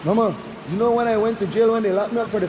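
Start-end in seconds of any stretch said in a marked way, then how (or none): none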